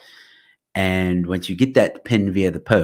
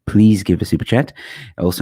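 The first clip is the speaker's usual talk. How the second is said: normal talking voice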